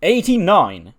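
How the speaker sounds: loud, high burst of delight at the score; real excitement over a great rating; excited shout. Real, not acted. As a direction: shout the number out, thrilled. Reacting to a high match rating.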